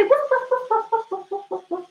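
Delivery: high playful woofs; cheerful high puppy barks; dog barking. Shown as a demonstration. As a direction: bark like a puppy for the kids. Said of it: "And we can say".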